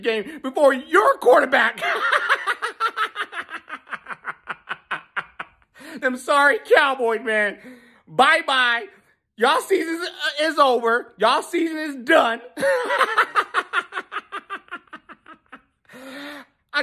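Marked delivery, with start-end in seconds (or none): 1.9-5.4 high cackling laugh; gleeful laugh. Real, not acted. Fan cackling after taunting the Cowboys, gleeful laugh. Gloating over rival's loss. 12.5-15.0 high cackling laugh; gleeful laugh. Real, not acted. Fan cackling after taunting the Cowboys, gleeful laugh. Gloating over rival's loss.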